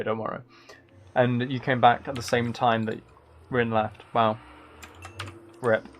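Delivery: usual speaking voice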